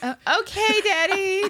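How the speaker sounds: high, whimpering fake-crying kid; mock tearful. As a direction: play a confused weepy child. Mock confused tearful kids.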